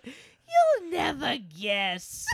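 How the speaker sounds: very slow, high teasing singsong; dragged out. Retold or imitated. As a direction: draw it out high and coy. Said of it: "dragged out every word".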